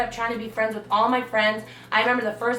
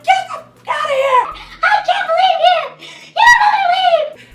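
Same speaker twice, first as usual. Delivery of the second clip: high, loud yelling with a laugh breaking through; furious shout; 9 dB louder than usual. Retold or imitated. Yelling at her cheating boyfriend, a high furious shout. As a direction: scream him out of the garage.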